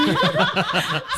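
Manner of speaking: high, loud burst of laughter. Real, not acted. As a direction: crack up at your own story. Funny ending of a story.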